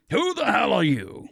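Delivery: lightly voiced growling demand; a hint of a snarl on the question; mock growl at the stranger. A narrator's reading. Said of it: "Vincent growled".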